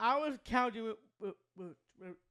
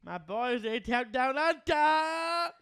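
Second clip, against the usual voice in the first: loud, drawn-out, rising higher; triumphant cheer; 4 semitones higher, 9 dB louder, slower than usual. Acted. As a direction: crow loudly for your team. Cheering for favorite team's win.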